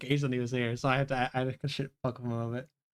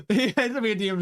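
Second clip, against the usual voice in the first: laughing; 8 semitones higher, 5 dB louder than usual. Real, not acted. Laughing while telling.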